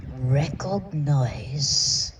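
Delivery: ordinary talk, nothing marked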